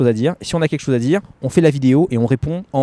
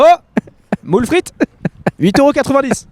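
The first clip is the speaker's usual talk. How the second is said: beach restaurant hawker; 12 semitones higher than usual. Retold or imitated. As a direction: hawk moules frites on the beach. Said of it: "Tu me fais penser à un restaurateur sur les plages de Nice".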